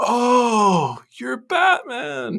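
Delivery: very high, loud, drawn-out mock wail; mock Batman gushing. Harley mocking Bruce's savior complex, high hammed-up wail. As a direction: stretch it out in an exaggerated high cry. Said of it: "you sound like".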